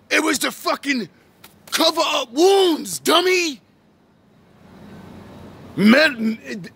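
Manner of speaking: real fired-up lecture, voice climbing; heated rant. Real, not acted. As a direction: hammer the point home at the audience. Ranting, outraged at listeners.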